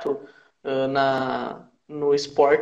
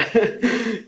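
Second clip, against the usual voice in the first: short higher-pitched chuckle; laugh; 6 semitones higher than usual. Real, not acted. Guest recalling the group's old web radio, chuckling. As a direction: chuckle at the memory.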